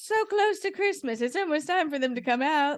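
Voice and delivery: high-pitched, eager voice; high excited. Retold or imitated. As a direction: squeal it like it's almost Christmas. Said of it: "I'm like".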